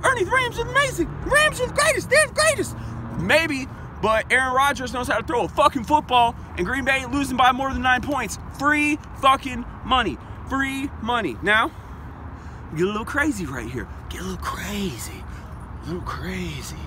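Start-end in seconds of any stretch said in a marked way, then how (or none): 0.0-2.8 high, squeaky mock fan insisting; mock doubter voice. Voices a doubter arguing with him. 12.8-16.8 soft teasing. Acted. Teasing a risky pick.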